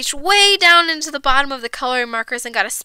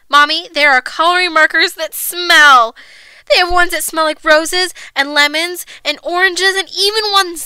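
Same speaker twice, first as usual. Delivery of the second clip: put-on higher, louder excited little girl; pleading child; 4 semitones higher, 6 dB louder than usual. A narrator's reading. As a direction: kid begging mom, gushing.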